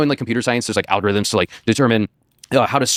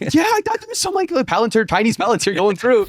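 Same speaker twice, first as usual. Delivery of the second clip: much higher, sarcastic retelling of his complaint; mock disbelief; 12 semitones higher than usual. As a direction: act amazed they can't find it. Mocking the expected surveillance tech.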